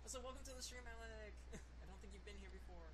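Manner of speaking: faint, distant echo of the voice; as if played back in a big hall; echoey. Real, not acted. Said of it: "I sound super echoey".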